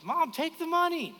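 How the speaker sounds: high-pitched imitation of an insistent child; little girl's voice. Daughter urging mom to accept the cash, high mock child voice. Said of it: "six or seven-year-old daughter with her".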